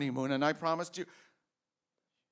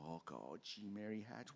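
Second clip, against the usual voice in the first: much softer, murmured to his wife; tender hush; 7 semitones lower, 14 dB quieter than usual. Acted. George softly to his wife Mary, tender and rueful. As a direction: drop to a near murmur, full of feeling.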